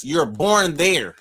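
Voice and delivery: slow emphatic. Real, not acted. Arguing the definition.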